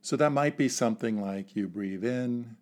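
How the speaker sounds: ordinary talk, nothing marked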